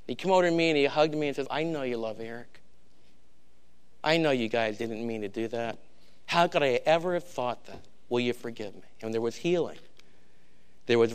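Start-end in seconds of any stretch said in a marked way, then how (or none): 0.0-8.8 soft, lowered, a touch of tears in the voice; tearful plea. Retold or imitated. Pastor retelling Bobby's tearful apology to Tim, soft and lowered. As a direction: retell it gently, as if choking up a little. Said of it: "the tears rolling down his face".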